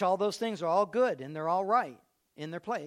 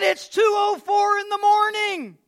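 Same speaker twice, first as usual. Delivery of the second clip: high, loud and slow; slow excited shout; 12 semitones higher, 12 dB louder, slower than usual. Retold or imitated. Father telling mother the clock time, shouting. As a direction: announce it like a miracle.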